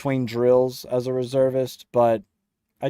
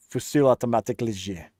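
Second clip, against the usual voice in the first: fast French accent on "Fusil automatique léger", rolled out with relish; faster than usual. Shown as a demonstration. A streamer savouring the French name in a French accent. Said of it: "say it in an accent".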